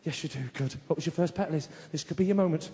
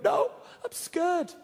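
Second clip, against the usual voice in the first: put-on high, slow, tearful little boy; lightly voiced scared kid; scared child voice; 8 semitones higher, much slower than usual. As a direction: whimper it like a frightened five-year-old. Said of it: "he was crying his eyes out".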